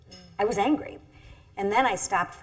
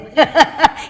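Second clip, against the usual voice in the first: loud real laugh, higher; 10 semitones higher, 15 dB louder than usual. Real, not acted. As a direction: burst into a laugh at your own joke. Laughs after joke about Barrick.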